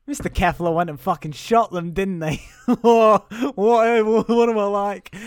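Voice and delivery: mock-merry outburst with big laughs; jolly young boy. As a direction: play a hammed-up gleeful kid. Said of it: "He seems very jolly about it".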